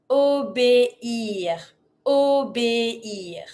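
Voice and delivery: "obéir" very slowly, "o-bé-ir"; separated vowels. Shown as a demonstration. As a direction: pull é and ir apart in "obéir". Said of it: "Really dissociate the E with the high accent and the I R".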